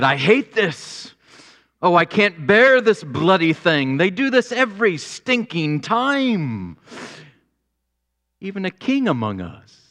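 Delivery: imitating an exasperated British king with a leaking pen; mock British king. Pastor imitating the king cursing his pen, mock British. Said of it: "I wish I had a British accent".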